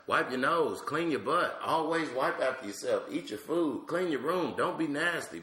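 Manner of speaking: put-on a toddler nagging like her mother; squeaky mock-mom list of orders; little girl imitating mama. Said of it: "She said".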